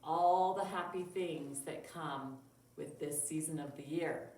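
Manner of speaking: put-on lively storybook voice for kids; reading to children. Teacher reading a Christmas story to children, lively storybook voice. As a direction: read it bright and expressive. Teacher reads picture book.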